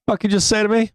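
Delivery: high, sharp mock outrage; fake-angry snap at a partner; retold spouse blowing up, hammed-up; mock furious disbelief. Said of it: "I'm like".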